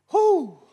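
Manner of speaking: high loud whoo. Real, not acted. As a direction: let out a sharp whoo of warning. Exclamation about lying.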